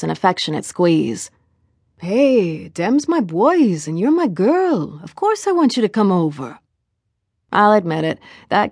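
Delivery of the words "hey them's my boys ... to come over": put-on lilting Jamaican accent. Boyfriend wants his girl to come over, lilting Jamaican accent. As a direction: draw out the affection in a Jamaican accent.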